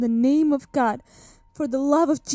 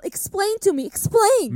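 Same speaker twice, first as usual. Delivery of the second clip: angry demand; 6 semitones higher than usual. Acted. Accused witch snapping at her interrogator, angry outburst. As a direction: demand an answer, voice breaking into anger.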